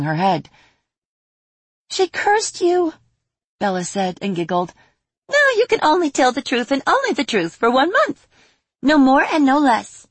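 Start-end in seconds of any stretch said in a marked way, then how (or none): 1.9-2.9 very high, drawn-out teasing; high slow fairy. Acted. Fairy giggling announcement. 5.3-10.1 high, louder gleeful pronouncement; high fairy voice. Acted. Fairy declares curse.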